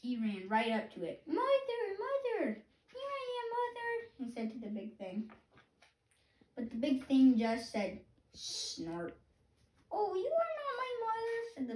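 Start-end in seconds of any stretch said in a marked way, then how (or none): 1.3-3.9 very high, eager chick calls; baby bird calling. Acted. Baby bird calling to the big thing, high eager. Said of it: "my baby bird voice". 8.3-9.2 low, short snort sound; a big machine grunting. Acted. Digger answering the bird, low snort. 9.9-11.4 high, small chick voice, lost and asking; a baby bird piping for its mother. Acted. Hatchling searching for its mother, high piping voice. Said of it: "my baby bird voice".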